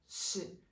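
just breathe out a plain "sh"; whispered sh sound. Shown as a demonstration. Teacher isolating the sh sound for students to copy. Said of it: "la X suena como una ch".

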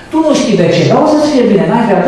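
high, eager coaxing voice; lightly acted high coaxing invitation; mock coaxing.

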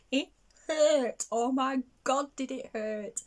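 high, loud, vivid insistence it hurt; reliving the sting of the dropped hoop; emphatic pain. Real, not acted. Warning viewers about a hoop hitting her toe, loud and pained.

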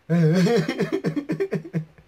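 loud high cackle; cartoon laugh. Real, not acted. Reaction video, laughing.

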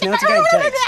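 an indignant shopkeeper squeaks at the customer; squeaky outburst. Acted.

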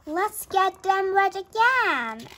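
high, loud and slow with glee; a big gleeful elephant plan; excited. Acted. Elephant's gleeful idea, ending.

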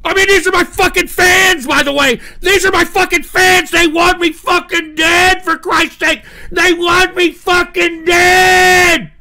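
high, loud outraged yelling; furious shouting. Real, not acted. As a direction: yell it, indignant, stretching the end. Rants that fans want him dead.

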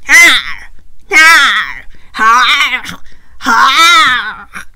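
a villainous belly laugh, loud; big evil laugh. A narrator's reading. The other dinosaur laughing over the trap. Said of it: "sounded like a big evil laugh".

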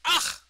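loud high disgusted grunt, imitating the teacher; a sharp fed-up ugh thrown at the student; scornful ugh. Teacher scoffing at a confused student, loud disgusted grunt.

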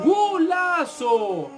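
long drawn-out high golazo; excited goal cry. Real, not acted. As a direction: stretch the goal shout out in joy. Streamer celebrating a goal.

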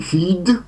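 feed with a drawn-out ee, rising; long i. Shown as a demonstration. Teacher models the first form, long-vowelled feed. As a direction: stretch the ee in feed. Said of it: "wydłużone i".

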